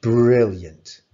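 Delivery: loud, bright burst of praise; proud teacher cheering a pupil's answer; enthusiastic praise. Real, not acted. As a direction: cheer the pupil on with one big word.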